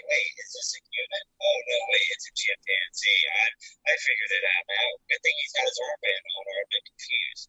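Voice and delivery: mock confused guard.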